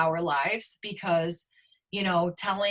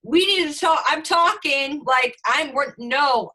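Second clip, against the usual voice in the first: loud, higher, insistent retort; agitated protest; 7 semitones higher, 8 dB louder than usual. Retold or imitated. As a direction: re-enact refusing to budge, voice raised. Retold argument, refusing to move.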